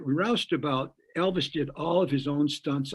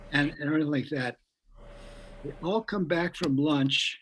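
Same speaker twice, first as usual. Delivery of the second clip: ordinary talk, nothing marked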